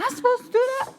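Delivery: very high-pitched mock yelp; imitated yelling. Coworker protesting her job at the food stand, high mock yell. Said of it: "she literally yelled at me".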